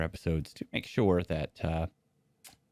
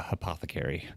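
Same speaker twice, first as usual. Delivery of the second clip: ordinary talk, nothing marked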